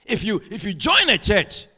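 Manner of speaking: high, loud, driving sermon voice; impassioned preaching. Real, not acted. Preacher telling church members to adapt, full-force preaching.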